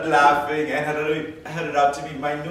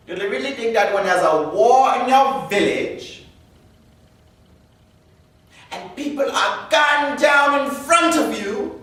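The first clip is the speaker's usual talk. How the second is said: high-pitched, building exasperation; incredulous rant; 9 semitones higher, 4 dB louder than usual. Acted. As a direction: pitch climbing in disbelief at the principals. Incredulous inner rant at principals.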